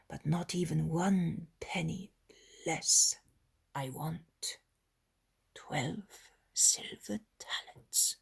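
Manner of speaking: hushed, low, unvoiced whisper of a miser; secretive whisper. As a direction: lean in and whisper the price like a secret. Isaac names his secret price.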